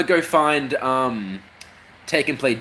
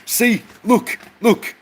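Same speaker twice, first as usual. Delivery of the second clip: high squeaky voice; 7 semitones higher than usual. Acted. Mocking high character voice.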